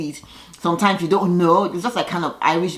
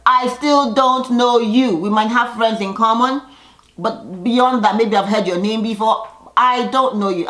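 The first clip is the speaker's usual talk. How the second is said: haughty snub; 5 semitones higher, 3 dB louder than usual. Retold or imitated. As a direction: talk down to a woman who keeps insisting. Eva's cold snub, retold.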